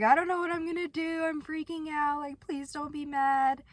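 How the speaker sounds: high, panicked rush of words; freaking out. Retold or imitated. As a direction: beg her not to be mad. Freaking out to sister.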